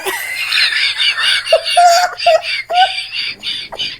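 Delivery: high wheezing laugh fit; breathless shrieking giggle; helpless laughter. Real, not acted. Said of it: "I can't talk".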